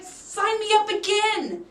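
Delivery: high, slow and bright-eyed; excited quote. Retold or imitated. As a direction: gush it like a new convert.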